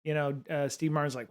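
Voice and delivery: ordinary talk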